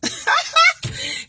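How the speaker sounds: high, loud burst of laughter; big laugh. Real, not acted. Laughing hard at her own joke to the ladies. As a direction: crack up at your own joke.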